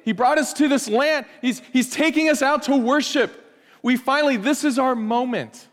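high, excited shouts of praise; rejoicing. Retold or imitated. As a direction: cheer like a freed people. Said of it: "they're saying".

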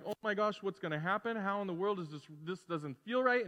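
ordinary delivery

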